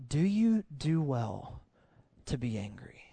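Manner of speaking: slow, hushed question; gentle, unraised voice. Acted. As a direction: ask gently, sinking to a whisper. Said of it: "God is not raising his voice".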